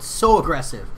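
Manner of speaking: ordinary delivery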